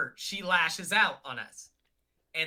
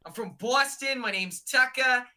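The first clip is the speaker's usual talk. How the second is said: Boston accent pitched higher and louder, a mock Tucker; Tucker's Boston accent; 5 semitones higher, 5 dB louder than usual. Doing friend Tucker in a mock Boston accent. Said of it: "here's my Tucker accent".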